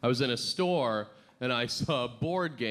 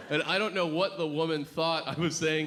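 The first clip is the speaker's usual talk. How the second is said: ordinary talk, nothing marked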